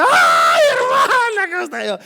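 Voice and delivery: high, loud squealing church lady; shrill-voiced excited sister greeting the pastor; gushing church lady. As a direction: gush over the pastor like an overeager lady.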